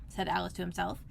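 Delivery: ordinary delivery, nothing marked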